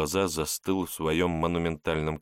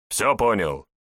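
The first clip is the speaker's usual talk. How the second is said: stern shout; 3 semitones higher, 5 dB louder than usual. Acted. Boss demands obedience.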